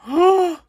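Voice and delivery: sudden high gasp; shocked gasp. Real, not acted. Kid reviewing the puppy, gasping in shock. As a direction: suck in air, caught off guard.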